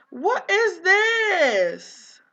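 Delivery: high, loud and very slow, near crying; shocked cry. Real, not acted. Vlogger shocked at what the Sim found, a high, loud, very slow crying wail. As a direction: wail it out in disbelief. Finds drugs in the bathroom.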